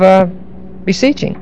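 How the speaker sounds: usual speaking voice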